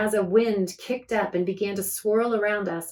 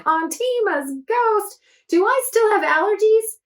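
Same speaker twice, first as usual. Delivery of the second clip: put-on exclaiming girl; 12 semitones higher, 5 dB louder than usual. Akiko's catchphrase, exclaimed.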